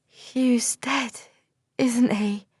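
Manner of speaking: a hint of the dazed girl asking about Hugh; dull voice. A narrator's reading. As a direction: say it numbly, lightly voiced girl. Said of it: "she said in a dull voice".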